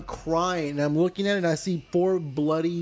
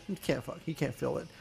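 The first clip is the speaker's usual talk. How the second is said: hushed, much softer voice; retold quiet aside; 3 semitones lower, 10 dB quieter than usual. Doctor speaking aside to the patient's dad, hushed. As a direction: drop it to a confidential aside.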